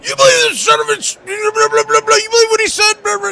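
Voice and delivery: high-pitched shouting rant, fast; furious rant. Retold or imitated. Yankee fan ranting to his friend, furious high shout. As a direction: explode in a shrill outraged yell. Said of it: "He lost it. Calls me".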